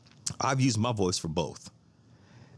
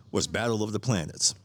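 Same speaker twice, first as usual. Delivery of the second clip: ordinary talk, nothing marked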